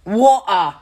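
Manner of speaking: "Water" with the T dropped, glottal catch in the middle; British glottal stop. Shown as a demonstration. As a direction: say "Water" as wo'-ah, no T. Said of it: "So they say water".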